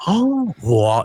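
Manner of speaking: retold childlike wow; amazed. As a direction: marvel like a child at a huge poster. Seeing his giant poster.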